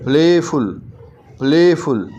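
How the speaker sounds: Playful twice, play lengthened; stress on PLAY. Shown as a demonstration. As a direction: lean on the first syllable play in playful. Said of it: "अब ये जो play है first syllable".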